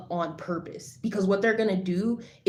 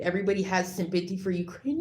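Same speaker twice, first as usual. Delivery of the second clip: ordinary talk, nothing marked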